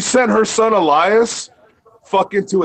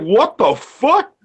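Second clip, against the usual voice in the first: higher, slow incredulous outburst; stunned slow what the fuck; retold disbelief; 6 semitones higher, much slower than usual. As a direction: stretch it out in disbelief. Reacting to mother's threat retold.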